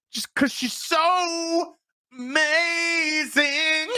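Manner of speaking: high, hugely drawn-out mock adoration; sing-song mock awe, very slow; sarcastic gushing. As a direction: gush it, stretching each vowel up high. Sarcastic gushing over the heroine.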